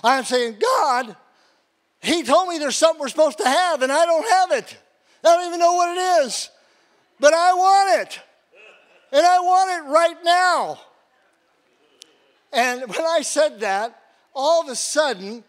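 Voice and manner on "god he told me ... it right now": much higher and louder, crying out demands; angry prayer. Retold or imitated. Frustrated worker yelling at God with his wheelbarrow, angry pleading shout. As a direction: demand it from God, rising in fury. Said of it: "I'm mad at God".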